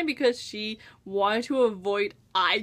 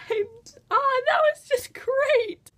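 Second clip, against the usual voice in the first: gushing; 13 semitones higher than usual. Real, not acted. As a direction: gush it way up high, drawing it out. Gushing over a favourite detail.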